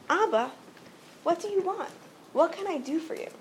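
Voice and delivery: mild eagerness to help, lifted pitch; excited son. Retold or imitated. Moshe answers his father's voice, mildly excited and eager. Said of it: "Moshe's so excited to hear his father".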